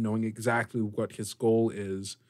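ordinary talk, nothing marked

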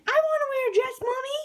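high-pitched put-on little voice; Dewey's voice. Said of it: "and he said".

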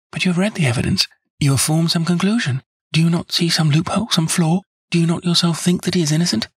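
put-on high, eager plea from a devoted young woman; earnest young woman. A narrator's reading. Miss Turner begging Holmes to clear James, eager and high. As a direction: insist on his innocence, breathless with hope.

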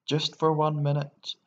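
high, loud wishful plea; persistent. A narrator's reading. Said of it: "he persisted".

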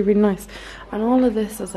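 usual speaking voice

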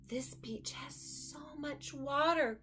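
slow, soft whisper; small and overwhelmed; awed whisper. Acted. Duck overwhelmed by sea.

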